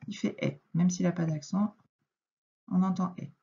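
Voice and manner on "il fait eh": "eh" said as an open è; "il fait eh" with a clear open è; eh sound. Shown as a demonstration. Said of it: "c'est comme s'il avait son accent".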